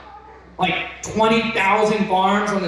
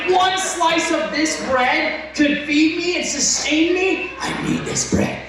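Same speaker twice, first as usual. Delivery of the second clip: high, slow wonder fading to whisper; awed excitement; 12 semitones higher, slower than usual. Retold or imitated. Amazed the bread sustains him, high awe. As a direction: marvel at the bread, then breathe it.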